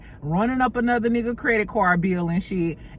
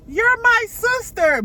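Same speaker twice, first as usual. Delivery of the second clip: high pleading mock whine; 13 semitones higher, 4 dB louder, slower than usual. [name] pleading in next episode.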